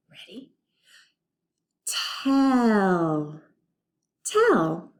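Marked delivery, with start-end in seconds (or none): 1.9-3.4 slow, seamless stretch; stretched smooth blend. Shown as a demonstration. Teacher modeling blending for students, slow and stretched. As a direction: stretch the word in one breath. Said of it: "Let's put that t at the beginning".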